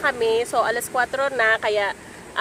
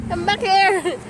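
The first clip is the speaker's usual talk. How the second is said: calling out; 5 semitones higher, slower than usual. Real, not acted. Mother calling her wandering child back, calling out. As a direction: call your little one back, pitch lifted.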